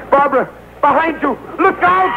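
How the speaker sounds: sudden high alarmed cry; alarmed warning shout. Acted. Simon shouting to Barbara as she's attacked, alarmed. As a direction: yell a warning across the deck.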